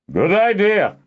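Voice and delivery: loud, high booming reply. A narrator's reading. Harper answers Kindon from the next room, booming. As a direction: boom it back from the other room. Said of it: "Master Zist boomed back".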